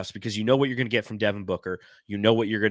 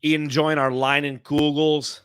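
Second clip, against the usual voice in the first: put-on chirpy, sing-song Minnesota accent, pitched higher; a light Minnesota accent, raised and louder at the start; 4 semitones higher, 4 dB louder than usual. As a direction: play a cheerful Minnesota local up north. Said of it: "I don't know if that's a good Minnesota accent".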